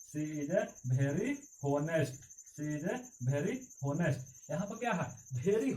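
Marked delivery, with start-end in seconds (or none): 0.1-2.2 she is a very honest, read word by word very slowly; slow dictation. Shown as a demonstration. Teacher dictating "She is a very honest" very slowly to students. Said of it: "पूरा clear होगा". 2.6-4.5 repeated very slowly, each word apart; slow dictation. Shown as a demonstration. Teacher repeating the sentence very slowly for students. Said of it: "पूरा clear होगा".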